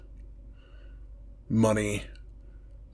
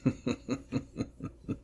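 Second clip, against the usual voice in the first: quiet, rapid evil snicker; soft villain laugh, mocking the mortal; long sinister chuckle under the breath; menacing chuckle; 3 semitones higher, 11 dB quieter than usual. Demon mocks protection circle.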